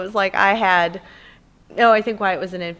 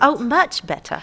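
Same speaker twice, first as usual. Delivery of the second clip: higher-pitched playful British; mock-British, pitched up; British accent; 5 semitones higher than usual. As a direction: show off a put-on British accent. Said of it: "my British has gotten a lot better".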